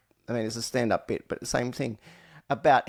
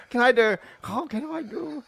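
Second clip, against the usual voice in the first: put-on high-pitched caricatured Japanese accent; mock Japanese accent; 10 semitones higher, 3 dB louder than usual. Asking co-host if he can do it, mock Japanese accent. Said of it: "Can you do a horribly racist".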